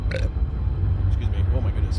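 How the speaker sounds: strained breathless choking whisper; choked whisper. Real, not acted. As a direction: squeeze it out while still choking. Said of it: "You ever just have, like, a whole, like, lung full of water?".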